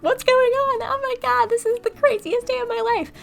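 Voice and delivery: very high, loud squeal of frenzy; hammed-up high-pitched hysteria; mock freak-out. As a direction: squeal like an overexcited fan losing it. Acting out the fans' frenzied reaction.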